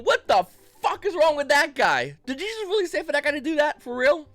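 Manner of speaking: baffled, half-shouting at the weird scene; incredulous outburst. Retold or imitated. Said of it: "I'm sitting there going".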